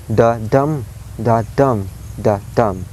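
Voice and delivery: "the dum" repeated, stress on each DUM; weak THE, strong DUM in "the dum"; iambic beat. Shown as a demonstration. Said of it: "the sound of the line is like".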